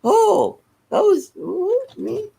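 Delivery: high squeaky mock surprise; imitating a stunned friend, voice jumps up; surprised. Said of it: "when he found out".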